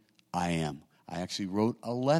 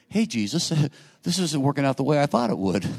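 higher, louder, half-laughing gripe; whiny complaint to Jesus; 6 semitones higher, 7 dB louder than usual. Pretend. Praying believer grumbles to Jesus, half-laughing whiny gripe. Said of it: "And you go".

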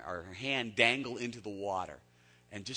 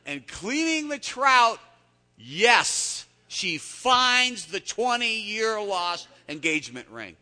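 much higher and louder, slow; storyteller hitting the punchline; excited reveal; 13 semitones higher, 16 dB louder, much slower than usual. Real, not acted. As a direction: savour the big surprise. Story climax, ring found.

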